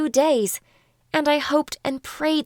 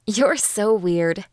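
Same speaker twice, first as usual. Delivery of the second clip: usual speaking voice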